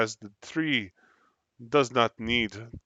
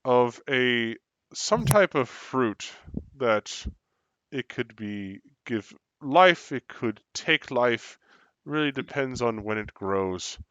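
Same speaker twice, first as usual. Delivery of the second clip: lore narration; 6 dB louder than usual. A narrator's reading.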